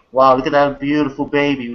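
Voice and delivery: ordinary talk, nothing marked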